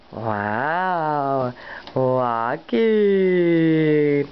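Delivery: ordinary talk, nothing marked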